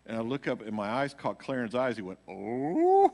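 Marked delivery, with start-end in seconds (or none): 2.3-3.1 surprised oh. Retold or imitated. Pianist reacts to the shaking.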